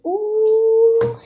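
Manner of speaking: long, high, sung ooh; high drawn-out ooh. Real, not acted. Reader reacting to a new card, high sung ooh. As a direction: hold a delighted-sounding high ooh.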